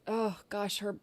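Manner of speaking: ordinary talk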